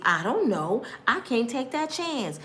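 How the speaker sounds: normal talking voice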